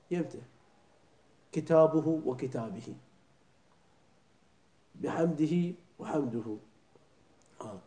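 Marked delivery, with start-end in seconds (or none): 1.5-2.9 slow كتابه with kitabuhu then wakitabihi, each vowel full; careful recitation. Shown as a demonstration. Teacher contrasting the vowels of كتابه for students. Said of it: "هاي الهاء إذا كانت مسبوقة بفتحة أو بضمة". 5.0-6.6 slow بحمده as biħamdihi, then وحمده as waħamduhu; careful recitation. Shown as a demonstration. Teacher showing the two vowel endings to students. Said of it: "هاي الهاء إذا كانت مسبوقة".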